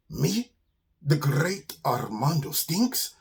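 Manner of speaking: put-on slow, accented, affronted disbelief; Armando the offended skunk. Skunk hears that he stinks, affronted. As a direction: repeat it grandly, wounded and incredulous.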